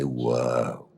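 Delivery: low, soft amazed whoa; quiet, impressed reaction; hushed awe. Acted. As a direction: let it out low and softly, awed. Listener awed by the rousing pitch.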